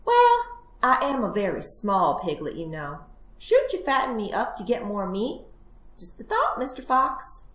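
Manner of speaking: cunning little piglet making excuses; wheedling piglet. Acted. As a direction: coax the fox with feigned innocence. Plays the piglet.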